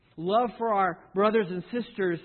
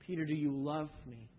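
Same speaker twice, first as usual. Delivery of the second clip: soft and a little lower; tender question; 4 semitones lower, 7 dB quieter than usual. Acted. Jesus pressing Peter again, softly. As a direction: ask again, gentler.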